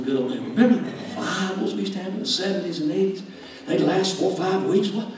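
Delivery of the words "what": ordinary talk, nothing marked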